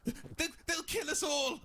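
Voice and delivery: panicked shout. Acted. Panic about the robots.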